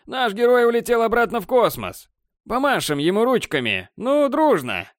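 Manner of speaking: высокий ёрничающий голос ведущего; разглагольствуй в микрофон для толпы; ведущий-балагур. Acted. Борланд продолжает в микрофон. (балагурит ведущий)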